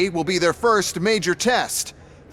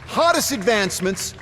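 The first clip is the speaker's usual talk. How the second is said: showman presentation; 4 semitones higher than usual. Acted. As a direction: sell the robots like a stage host. CEO presents robots at arms expo.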